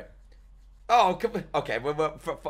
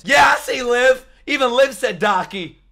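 loud, higher excited yell; excited shout; 10 semitones higher, 9 dB louder than usual. Real, not acted. Streamer excitedly spotting a viewer in chat, shouting. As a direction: call out with glee.

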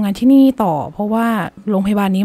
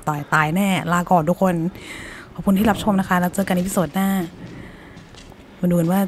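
hiding whisper; 4 semitones lower, 5 dB quieter than usual. Real, not acted. Hiding under bed, signing off.

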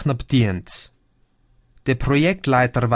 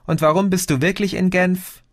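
put-on a lightly higher young woman's voice; Chrissy on the phone; 7 semitones higher than usual. Cousin Chrissy calls Gio, lightly voiced higher. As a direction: play the cousin calling, a bit lifted.

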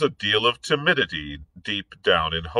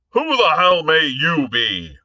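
higher, louder outburst; exploding; 9 semitones higher, 5 dB louder than usual. Acted. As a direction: blurt it in a sudden rage. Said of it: "he exploded".

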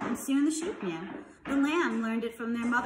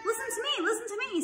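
mild mock scream; 12 semitones higher, faster than usual. A narrator's reading. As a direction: plead for attention in a high voice. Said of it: "screamed the goose".

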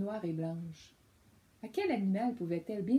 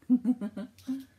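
giggle; 4 semitones higher, 9 dB louder than usual. Real, not acted. Child laughs at the owl.

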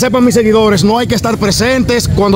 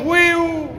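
mock whistle; 4 semitones higher than usual. Mock whistle as detainee passes.

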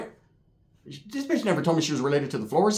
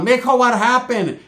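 higher, louder, drawn-out grandma cry; alarmed grandma; 7 semitones higher, 6 dB louder than usual. Retold or imitated. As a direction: imitate a worried grandmother calling out. Grandma's alarmed question, retold.

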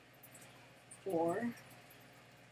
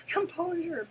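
normal talking voice

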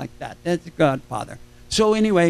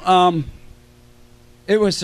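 ordinary talk, nothing marked

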